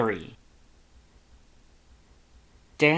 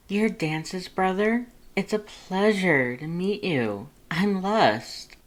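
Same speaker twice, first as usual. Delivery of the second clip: higher, slow, light character voice; Lust's voice; 9 semitones higher, slower than usual. Acted. Lust introduces himself to Dance's brother, light and high. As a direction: introduce yourself sweetly, up high.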